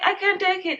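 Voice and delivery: high-pitched pleading protest, retold; overwhelmed protest. Mother to partner as baby cries, mildly overwhelmed. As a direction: act out being unable to bear it.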